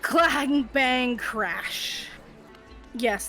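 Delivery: normal talking voice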